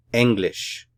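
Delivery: "English" as German ENG-lish, first syllable strong; "English" falling away after a stressed ENG. Shown as a demonstration. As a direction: hit the "Eng" of "English", with an open e. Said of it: "Stress is here on the first syllable".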